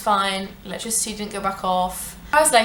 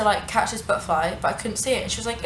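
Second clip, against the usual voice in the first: usual speaking voice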